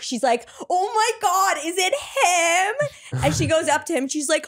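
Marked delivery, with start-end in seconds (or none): 0.7-3.2 high, drawn-out whine of disbelief; mock excited friend. Friend spots the hot guy at the club, mock excitement. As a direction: play the friend squealing, stretching the last words. Friend reacts to spotting him.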